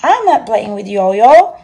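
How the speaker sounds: curt, loud and indignant; a sharp mother putting a policeman in his place; sharp retort. Acted. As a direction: snap back at the policeman. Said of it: "Missus Lamb Chop said sharply".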